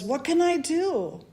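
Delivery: a worried king begging for help; pleading king. Acted. Distraught king asking the smartest people, pleading.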